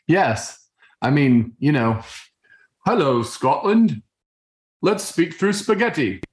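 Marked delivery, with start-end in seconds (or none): 2.8-6.2 a hammed-up, amateur British accent, going higher on the second line; bad British accent. Dad jokingly greets Scotland in a bad British accent. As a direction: put on a clumsy British accent as a joke. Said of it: "in a very bad British accent".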